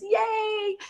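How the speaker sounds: very high, playful yay; cheery yay. Retold or imitated. Park worker cheering her tree photo, very high playful cheer. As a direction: cheer like a delighted child. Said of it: "He goes".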